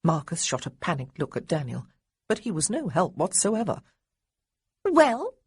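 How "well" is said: high, loud impatient demand. A narrator's reading. Little girl demanding Marcus's answer, loud and high. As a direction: insist on an answer now. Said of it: "Honoria demanded".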